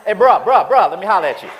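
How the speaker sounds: loud bruh, let me holler at you; street-talk confrontation. Retold or imitated. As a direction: get loud and street with the usher. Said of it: "All training out the window".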